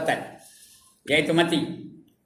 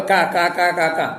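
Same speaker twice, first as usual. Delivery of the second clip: louder imitated guffaw, kak kak; mock laughter; 3 semitones higher, 5 dB louder than usual. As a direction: belt out a mocking laugh. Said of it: "Tengah galak ketawa".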